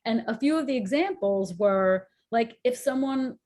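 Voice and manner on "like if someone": ordinary talk, nothing marked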